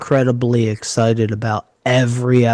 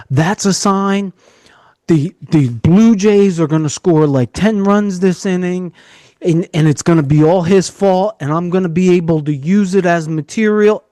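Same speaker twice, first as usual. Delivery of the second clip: mock panicked prediction, higher and half joking; goofy; 5 semitones higher than usual. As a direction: play it like a superstitious fan seeing an omen. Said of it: "I got goofy like you".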